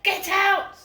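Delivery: high, loud imitated yell; mum's sharp shouted order; shouting. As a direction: shout it at someone who hasn't listened. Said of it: "she shouted at me like that".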